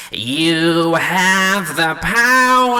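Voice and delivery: hammy cartoon villain bellowing his line; Skeletor voice. Shown as a demonstration. Singer doing Skeletor for a vocal take, nasal and slow. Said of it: "Really nasally and, like, in character".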